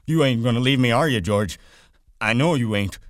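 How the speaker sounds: Lenny on his knees pleading with George; anxious plea. A narrator's reading. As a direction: beg for reassurance.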